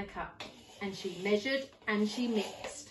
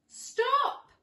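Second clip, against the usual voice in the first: high, loud shout; old man shouting after the gingerbread man; cry of stop; 5 dB louder than usual. Acted. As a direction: yell after the runaway. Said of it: "meowed the cat".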